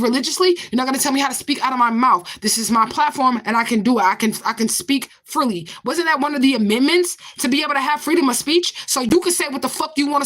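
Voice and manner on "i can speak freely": ordinary delivery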